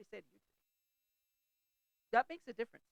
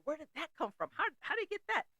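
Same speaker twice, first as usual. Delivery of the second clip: much higher, bewildered retold surprise; surprised; 12 semitones higher than usual. Finding the sign on his desk at work, high retold surprise. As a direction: voice jumping up in amused disbelief.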